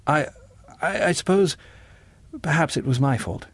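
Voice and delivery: halting, uncertain apology; hesitant stammer. A narrator's reading. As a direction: falter with pauses between starts. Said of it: "stammered John".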